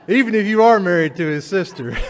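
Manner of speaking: loud, high and laughing through the words. Real, not acted. Preacher tells congregation through laughter, loud laughing delivery. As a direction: push the line out while cracking up.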